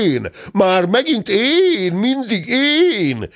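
high, drawn-out wail of protest; outraged bellow. A narrator's reading. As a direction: stretch each "én" into an indignant howl. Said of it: "ordított fel a téns úr".

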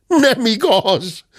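high, drawn-out indignant denial; outburst. A narrator's reading. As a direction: burst out in slow, high protest. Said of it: "tört ki a tekintetes úr".

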